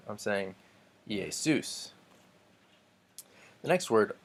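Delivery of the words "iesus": "Iesus" with the stress on SUS, ie-SUS; stress on final diphthong. Shown as a demonstration. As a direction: save the weight for the end of "Iesus". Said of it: "entire diphthong gets the emphasis".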